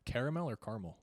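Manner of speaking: caramel as "CARE-a-mel", then as "CAR-mel"; caramel in three syllables, then two; two pronunciations. Shown as a demonstration. Asking the co-host which is right, caramel two ways. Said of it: "wondering why we pronounce it".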